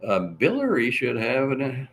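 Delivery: ordinary talk, nothing marked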